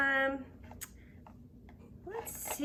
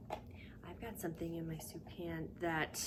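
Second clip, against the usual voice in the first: whisper; 3 semitones lower, 6 dB quieter than usual. Pretend. Whispered clue.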